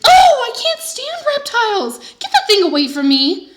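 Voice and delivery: high, loud, slow shriek. A narrator's reading. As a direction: shriek in horror. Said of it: "Missus Yarby shrieked".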